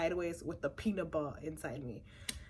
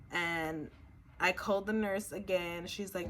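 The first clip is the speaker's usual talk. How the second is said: ordinary talk, nothing marked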